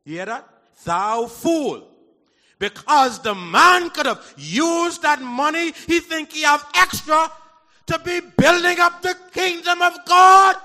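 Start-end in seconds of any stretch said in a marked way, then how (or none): 0.8-1.9 loud rebuke. Acted. Repeats rebuke louder. 2.6-10.6 loud, slow, rising preacher shout; booming sermon climax; full-force preaching. Acted.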